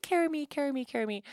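high, imitating a toddler's nagging whine; mother imitating her toddler's endless plea; whiny toddler. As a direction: mimic a child begging to be carried.

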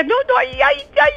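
high, giddy cries of joy re-enacted; excited squeals. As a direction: squeal it like the goal just went in. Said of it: "y decía". (chillidos de alegría muy agudos, recreados)

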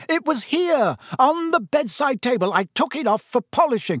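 high-pitched agitated mock shout. A narrator's reading. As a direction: protest in a panicky high voice. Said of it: "Percy was shouting".

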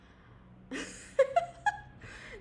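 very high breathy laugh; crying laughter. Real, not acted. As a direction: laugh till near tears. Laughs at bad joke.

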